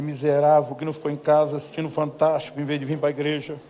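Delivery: loud indignant blast at the brother; mock furious outburst. Pastor venting in jest at the generous brother, loud mock fury. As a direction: hurl it out in mock outrage. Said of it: "Minha vontade é dizer".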